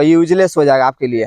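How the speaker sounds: ordinary delivery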